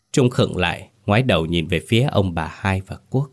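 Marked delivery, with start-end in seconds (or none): none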